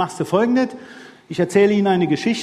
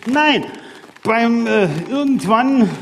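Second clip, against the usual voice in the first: normal talking voice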